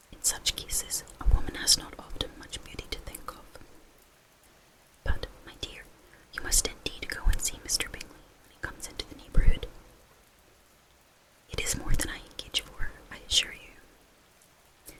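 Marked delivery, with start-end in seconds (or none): none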